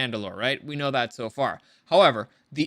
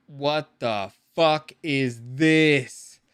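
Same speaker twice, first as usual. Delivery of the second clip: incredulous; 3 semitones lower, 3 dB louder, much slower than usual. Retold or imitated. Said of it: "Bo-Katan is like".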